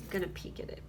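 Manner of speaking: softly whispered to herself; whispered aside. Real, not acted. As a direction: hush it like a sneaky aside. Sneaking a look at card.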